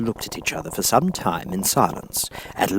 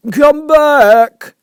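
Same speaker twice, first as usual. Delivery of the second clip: very high, loud call; calling after; 15 dB louder than usual. A narrator's reading. Caterpillar calls after departing Alice, loud and high. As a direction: shout after someone walking away. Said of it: "the caterpillar called after her".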